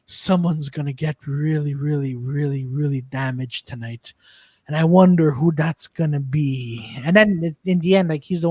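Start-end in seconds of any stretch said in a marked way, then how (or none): 0.1-6.9 lower, slow menacing murmur; imitating Steven Seagal. Seagal threatening bad guys with a sword, low slow menace. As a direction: drop it low and slow like a tough guy.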